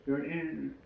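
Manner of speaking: soft, swallowed mock mumble; too quiet to hear. Retelling the mother asked to repeat, too quiet to hear. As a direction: imitate a barely audible reply. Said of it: "I can't hear".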